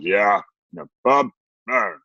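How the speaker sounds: lightly voiced mumbling teen grunt; mock a bored teenage son's one-syllable grunts; grunting teenager. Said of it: "talks like this in one syllables".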